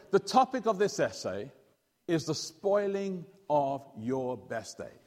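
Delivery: very slow, deliberate teacher announcement; slow teacher assignment. Retold or imitated. English teacher giving the class an essay topic, very slowly. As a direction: spell out the topic word by word.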